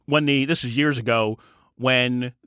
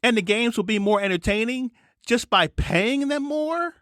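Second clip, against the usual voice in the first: worked-up, much higher pitch; riled up, voice climbing high; exasperated rant pitched way up; voice too high; 10 semitones higher than usual. Real, not acted. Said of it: "voice been so high this entire show".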